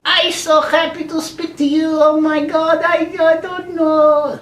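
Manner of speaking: overjoyed relative on the phone; emotional auntie. Retold or imitated.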